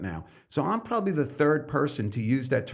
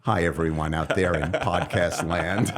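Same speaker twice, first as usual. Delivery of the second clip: mock podcast host; 7 semitones lower than usual. Mocking a podcast host greeting.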